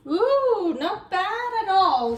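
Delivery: ordinary talk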